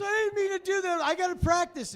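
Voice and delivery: put-on very high, loud, upset little boy; high, tearful child's voice; crying kid. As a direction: wail it like a child who's embarrassed. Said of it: "he started to cry and he got upset".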